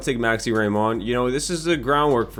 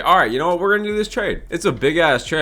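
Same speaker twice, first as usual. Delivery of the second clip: excited outburst; 8 semitones higher, 4 dB louder than usual. Real, not acted. Sudden decision to make trade.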